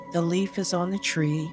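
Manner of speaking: normal talking voice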